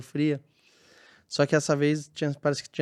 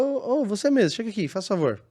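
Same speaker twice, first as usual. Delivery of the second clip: high, louder call down the corridor; 8 semitones higher, 3 dB louder than usual. Retold or imitated. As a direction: flag him down, higher and louder. Calling a crying employee into a room.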